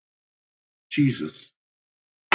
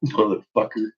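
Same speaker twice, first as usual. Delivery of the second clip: ordinary talk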